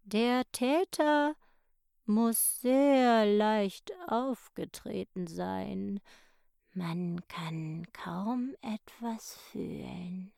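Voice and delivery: sehr langsame, raue Schneckenstimme; alte Schnecke, bedächtig. A narrator's reading. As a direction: lass jedes Wort wie eine Schnecke kriechen. Snail voice continues, slow. (die alte Schnecke tastet den Tatort ab, sehr langsam)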